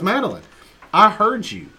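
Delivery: scolding mom. Retold or imitated. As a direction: call her out like a mom who heard it.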